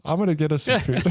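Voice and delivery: high, cheeky cat voice with a laugh. Retold or imitated. Cat announcing it will fetch food, high and laughing. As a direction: call out like a sneaky cat.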